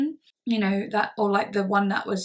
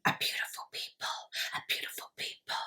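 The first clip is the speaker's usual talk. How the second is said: fast, soft, creepy whisper; 12 dB quieter, faster than usual. Retold or imitated. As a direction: rattle it off in a hiss. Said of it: "just like [name]".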